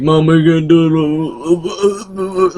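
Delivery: slow, lightly voiced whiny little boy; a hint of a frightened child begging his mom; mock scared kid. Mocking the kid Sam asking his mom, slow and whiny. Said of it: "little freaking Sam was like".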